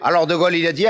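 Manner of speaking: ordinary talk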